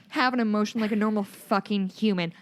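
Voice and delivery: usual speaking voice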